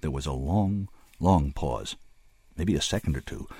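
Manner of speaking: ordinary delivery, nothing marked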